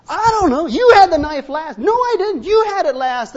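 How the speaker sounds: high, loud mock spat between spouses; mock bickering. Husband and wife blaming each other over the knife, mock bickering. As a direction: squabble shrilly, hammed-up.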